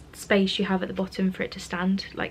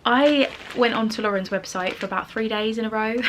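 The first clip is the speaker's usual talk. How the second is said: ordinary delivery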